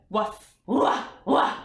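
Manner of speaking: mid-pitch barking; medium dog bark. Shown as a demonstration. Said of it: "un chien un petit peu plus, médium".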